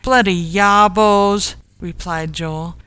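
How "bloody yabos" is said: louder, indignant grumble; annoyed curse. A narrator's reading. Joel blaming whoever threw stones, annoyed curse. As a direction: curse the culprits.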